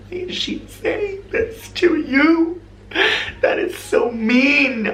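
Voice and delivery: high, slow, appalled disbelief; mock-appalled at the rude comment; shocked outrage. She reacts to the ex-colleague's remark on the haircut with high, slow outrage.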